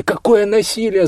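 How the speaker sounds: high-pitched outraged cry; breathless cry. A narrator's reading. Olya to officer suggesting violence, breathless outraged cry. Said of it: "задыхаясь, крикнула она".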